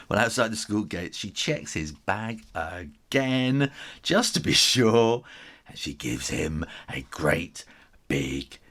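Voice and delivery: ordinary delivery, nothing marked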